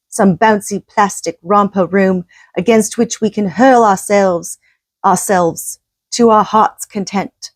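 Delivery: put-on English accent. Host reading Johnson's earth warning in a light English accent. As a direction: quote the speech with a hint of English accent. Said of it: "I'm gonna try and do my English accent".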